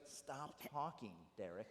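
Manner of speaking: low, soft, slow hush; soft mock scolding. Brother mimicking his sister hushing him, softly. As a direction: lightly imitate the sister telling him to hush. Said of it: "she's like".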